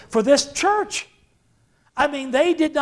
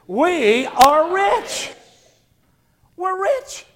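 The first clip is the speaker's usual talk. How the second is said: said with conviction; 6 semitones higher, much slower than usual. Shown as a demonstration. Pastor urging the congregation to declare it with conviction. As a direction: make the room believe it. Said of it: "Say it again like you believe it".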